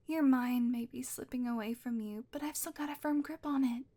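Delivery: ordinary delivery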